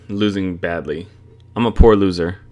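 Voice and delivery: usual speaking voice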